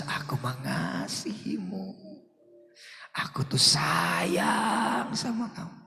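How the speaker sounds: slow, breathy whisper of love; slow soft whisper of God's love; God's tender voice. Retold or imitated. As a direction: whisper it softly like God speaking to the heart. God speaking in his heart.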